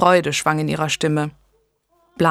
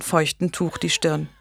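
ordinary delivery, nothing marked

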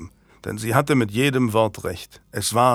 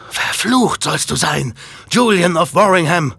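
furious curse; 7 semitones higher, 5 dB louder than usual. A narrator's reading. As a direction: spit the curse at him, louder and louder. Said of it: "Mit einem wütenden Fauchen".

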